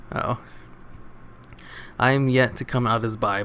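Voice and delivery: ordinary delivery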